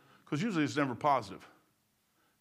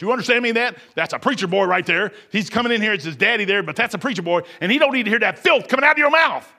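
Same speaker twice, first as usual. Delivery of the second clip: retold threat; 5 semitones higher, 7 dB louder than usual. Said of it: "He said".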